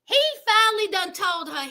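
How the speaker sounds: ordinary talk, nothing marked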